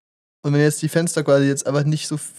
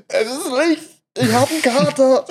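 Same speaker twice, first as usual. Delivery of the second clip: higher, laughing mock hungover groan; 9 semitones higher than usual. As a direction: whine like someone hungover and blinded.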